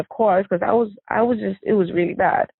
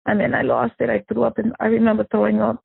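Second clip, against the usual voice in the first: usual speaking voice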